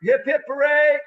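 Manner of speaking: loud, high group cheer; hooray cheer. Real, not acted. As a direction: shout it out with joy. Group cheer closing the show.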